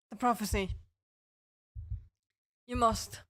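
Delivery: hammy dying spirit with fake coughs; overacted death scene. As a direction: croak out a dying prophecy, coughing. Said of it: "dying spork monster prophecy with coughs".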